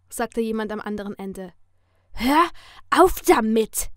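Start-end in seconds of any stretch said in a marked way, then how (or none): 2.1-3.9 loud, slow, angry snarl; snapped hiss. A narrator's reading. She snaps at her stalker on the phone, hissing angrily. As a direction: spit it out forcefully, word by word. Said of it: "fauchte ich nur".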